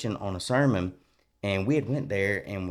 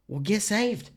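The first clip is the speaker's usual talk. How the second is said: high, matter-of-fact wife voice; mock her plain advice, pitched up; mimicking his wife; 11 semitones higher, slower than usual. Husband mimics wife's simple answer in a high voice. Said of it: "She's like".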